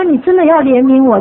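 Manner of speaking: laughing plea. Retold or imitated. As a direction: begs the Lord for mercy while laughing at herself. Pleading prayer, laughing.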